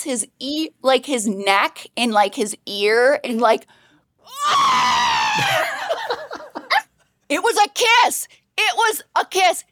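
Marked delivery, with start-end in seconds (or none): 4.3-6.8 long, very high squeal into laughter; mortified squeal. Shown as a demonstration. Acting out the neck kiss. 7.3-9.6 high, emphatic wail; mortified admission. Real, not acted. Admitting the embarrassing kiss.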